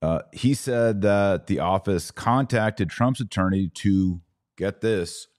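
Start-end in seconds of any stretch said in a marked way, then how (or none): none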